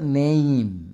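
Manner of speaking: নেম drawn out as "ne-im"; model pronunciation. Shown as a demonstration. Teacher showing how the word is said, model pronunciation of নেম as a long "neim". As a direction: stretch the vowel of নেম into a long "ei" glide. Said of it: "এর উচ্চারণটা হচ্ছে".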